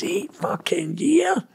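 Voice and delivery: slow, high incredulous question; stunned disbelief. Retold or imitated. Hearing his brother got eight years, slow stunned question. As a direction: drag it out in disbelief.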